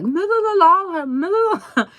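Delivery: put-on high, loud, pleading child's cry; desperate calling out. Hurt little girl calling her teacher, high and loud. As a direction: call the teacher with a bloody mouth.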